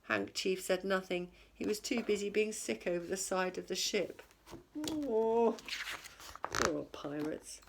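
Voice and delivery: plain reading voice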